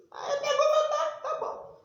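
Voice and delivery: can't hold back a laugh while retelling. Real, not acted. Laughing while telling how grandma gave in.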